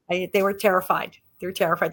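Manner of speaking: ordinary talk, nothing marked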